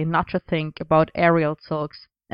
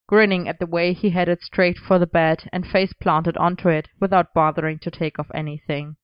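plain reading voice

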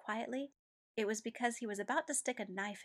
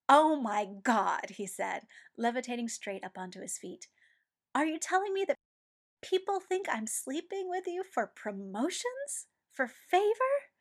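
loud, much higher, incredulous outburst; outraged; 5 semitones higher, 7 dB louder than usual. A narrator's reading. Ed outraged at rumors about him and Mustang. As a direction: explode in disbelief.

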